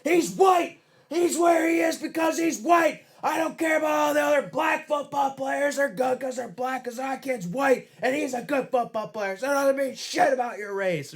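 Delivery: high-pitched yelled tirade; screaming rant impression. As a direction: rant at full pitch like an amped-up madman. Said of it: "he was screaming and yelling".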